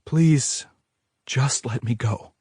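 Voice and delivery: trembling plea; pleading. A narrator's reading. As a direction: beg to be let go. She begs, trembling.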